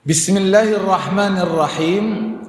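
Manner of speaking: usual speaking voice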